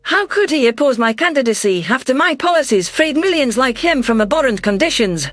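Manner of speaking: ordinary delivery, nothing marked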